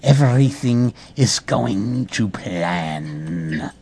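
slow, low, soft scheming; hammed-up sinister musing; sinister villain. A narrator's reading. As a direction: draw it out like a gloating mastermind. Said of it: "in a most sinister manner".

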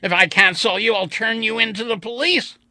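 high, loud, furious threat; angry shout. Acted. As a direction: yell it in a rage.

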